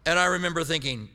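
ordinary delivery

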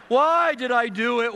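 high, slow, loud lament; wailing child. Retold or imitated. Son wailing in his room after being caught, slow and loud. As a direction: cry it out slowly like a sorry child.

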